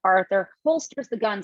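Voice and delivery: usual speaking voice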